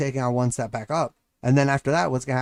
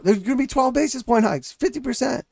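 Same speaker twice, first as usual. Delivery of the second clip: much higher, dopey rambling voice; mock the dumb neighbor blurting numbers; silly high neighbor babble; moronic neighbor; 9 semitones higher than usual. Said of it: "It sounds stupid".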